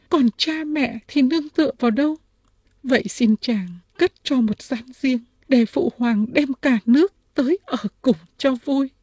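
high, feminine princess voice; narrator's acted high pleading voice. A narrator's reading. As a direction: make the request in a high-pitched princess voice.

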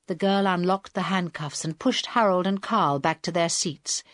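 ordinary delivery, nothing marked

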